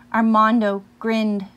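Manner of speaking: usual speaking voice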